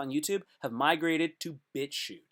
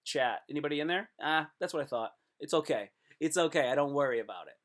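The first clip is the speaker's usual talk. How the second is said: usual speaking voice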